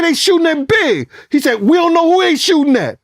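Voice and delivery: alarmed exchange. Retold or imitated. Kenny and narrator argue.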